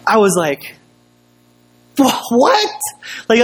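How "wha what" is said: high-pitched stammered disbelief; incredulous. Retold or imitated. Pastor reacting to the half apology, incredulous. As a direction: sputter it, rising high on WHAT. Retelling his stunned reaction.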